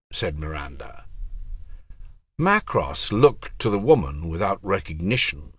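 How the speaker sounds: ordinary delivery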